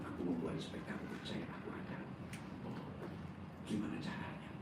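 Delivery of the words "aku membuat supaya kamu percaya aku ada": quiet divine voice. Retold or imitated. Retelling God's hushed answer.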